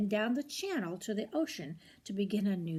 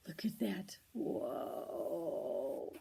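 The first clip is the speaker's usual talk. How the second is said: hushed whisper of amazement; whispered aside; 4 semitones lower, 6 dB quieter, much slower than usual. Real, not acted. Reader whispering in wonder to a child at the big eight. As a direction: breathe it out, awed and hushed.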